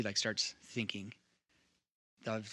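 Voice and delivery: normal talking voice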